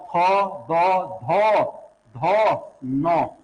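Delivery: थो, दो, धो drawn out slowly with the tongue on the teeth; teacher slowly voicing धो and नो at the teeth; dental sounds demo. Shown as a demonstration. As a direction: stretch थो दो धो नो for the class. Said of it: "ऐसे बोले बे".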